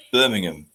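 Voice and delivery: Birmingham with the h dropped, "um" at the end; proper Birmingham. Shown as a demonstration. Correcting listeners: Birmingham as "BUR-ming-um". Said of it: "it's pronounced".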